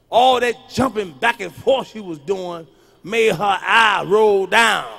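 slow, loud, building to a shout; drawn-out shouted punchline. Real, not acted. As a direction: drag out and yell the punchline.

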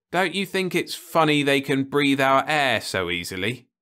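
hint at the rumour; probing uncle. Acted.

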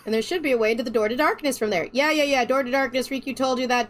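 ordinary talk, nothing marked

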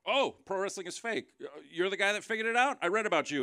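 mock him like he cracked a great mystery; sarcastic mock. Mocking the heckler sarcastically.

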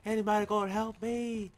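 usual speaking voice

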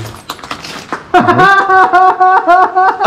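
bursts out laughing. Real, not acted. Laughing at the lower balcony.

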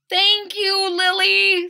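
squeaky eager thanks; grateful boy. Acted. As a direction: Wilson thanking Lily, high and loud. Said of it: "said Wilson".

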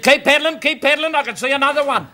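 high, excited urging; urgent shout. Acted. Doctor spotting another baby, high urgent shout. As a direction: yell out to keep someone going.

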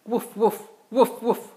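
high, loud playful woof woof; puppy bark. Acted. Dad reading to his kids, barking loudly as the puppy. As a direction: bark like an eager little puppy.

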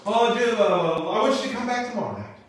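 high, hesitant start, then rushed out; stall, then blurt the excuse quickly; chickening out. Retold or imitated. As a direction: lose your nerve and hurry through it. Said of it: "and she chickens out again".

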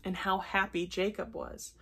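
usual speaking voice

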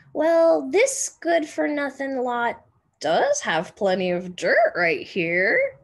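put-on loud, gruff old neighbour; grumpy old man. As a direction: bark it like a cranky old man.

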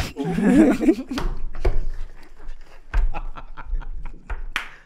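long high wheezing laugh; incredulous laughter. Real, not acted. Man cracking up at talk of restaurant dates, incredulous laughter. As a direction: crack up helplessly at the idea.

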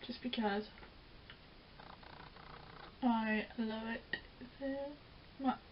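lips stretched while applying lipstick; talking while painting lips; while putting on lipstick. Real, not acted. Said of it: "It's really hard to talk while putting on lipstick".

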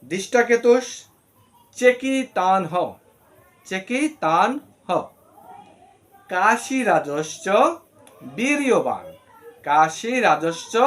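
each word drawn out slowly with pauses; slow Sanskrit example. Shown as a demonstration. Teacher giving a slow Sanskrit pronunciation example. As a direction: model the words carefully, one at a time. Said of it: "যেমন".

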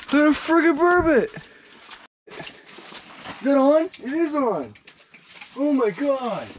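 very high, loud excited yelling; excited shouting. Real, not acted. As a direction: shriek with joy at a rare catch. Surprise catch of a burbot.

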